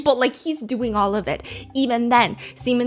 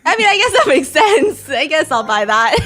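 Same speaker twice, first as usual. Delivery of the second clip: higher and louder, laughing through the words; laughing mock customer; 5 semitones higher, 6 dB louder than usual. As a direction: giggle your way into agreeing to buy.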